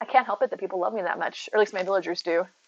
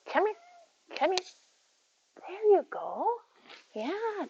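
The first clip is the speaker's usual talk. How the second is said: high pet talk; 9 semitones higher, 7 dB quieter, much slower than usual. Real, not acted. Baby talk to the cat.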